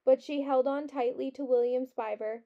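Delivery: plain reading voice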